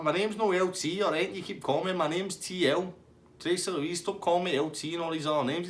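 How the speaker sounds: high-pitched imitated telling-off; mock exasperated woman. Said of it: "She's like".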